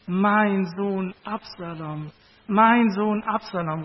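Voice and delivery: slow, loud lament calling the son's name; grieving cry. Acted. Weeping king calling out for his dead son: loud, slow lament. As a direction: cry out in grief. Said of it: "rief er im Gehen".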